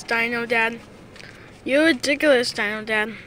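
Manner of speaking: ordinary delivery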